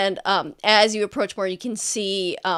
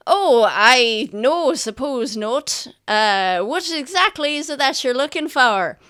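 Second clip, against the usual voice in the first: nervous merchant; 4 semitones higher, 8 dB louder than usual. Acted. Merchant startled by search.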